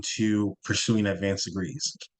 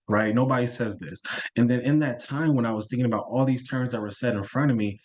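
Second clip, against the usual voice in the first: ordinary delivery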